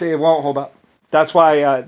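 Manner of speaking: usual speaking voice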